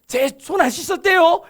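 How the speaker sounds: high, loud tattletale outburst; tattling Pharisee. Retold or imitated. Pharisees rushing to Jesus to tell on someone, high urgent tattling. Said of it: "바리새인들도 막 득달같이 쫓아와가지고".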